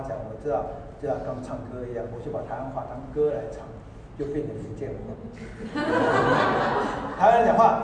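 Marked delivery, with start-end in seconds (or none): 5.0-7.1 laughing. Real, not acted. Laughing at own joke.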